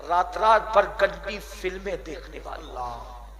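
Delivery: ordinary talk, nothing marked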